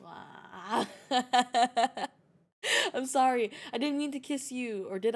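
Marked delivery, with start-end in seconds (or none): 0.9-2.1 rapid ha-ha laugh; real, loud giggling fit; burst of laughter. Real, not acted. Streamer cracking up on stream, real laughter.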